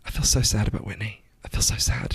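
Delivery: soft, low, imitated near-tearful grief; mock being on the verge of tears, hushed; almost crying. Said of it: "She's almost crying".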